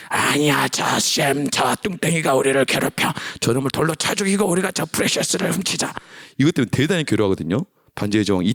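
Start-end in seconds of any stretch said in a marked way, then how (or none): 0.1-5.9 wicked side, raised creature rasp; Gollum. Acted. Wicked side urging a murder and theft, creepy scheming. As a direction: scheme nastily in a strained creature voice. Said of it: "또 바뀌".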